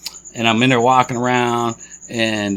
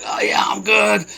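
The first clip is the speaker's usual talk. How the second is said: high, strained shout of forced reassurance; strained fake-fine; 9 semitones higher than usual. Man holding his injury tells worried shoppers he's fine, strained high shout. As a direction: pretend you're fine through the pain. Retold reply while in pain.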